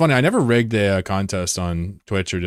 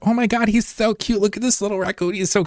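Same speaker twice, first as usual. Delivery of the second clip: cooing over cute raccoon; 12 semitones higher than usual. Retold or imitated. Streamer mimicking herself doting on a raccoon, gushing coo. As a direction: gush over the little raccoon. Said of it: "I'm up here like".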